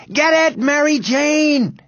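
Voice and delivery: high, drawn-out desperate shout; breathless shouting. A narrator's reading. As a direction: shout with your last breath. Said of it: "Henry shouted".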